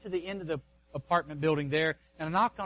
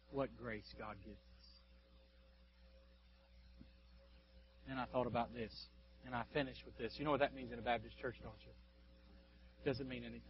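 hushed reverence; 5 semitones lower, 16 dB quieter than usual. Real, not acted. Preacher to congregation after the peak, hushed and soft. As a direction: bring it down to a near hush.

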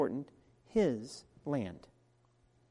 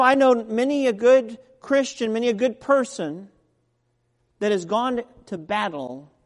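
louder, higher preaching build; full-force preaching; 5 semitones higher, 11 dB louder than usual. Acted. Preacher pressing the point on the congregation, much louder. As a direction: drive it home from the pulpit.

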